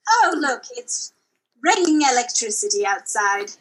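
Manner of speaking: ordinary delivery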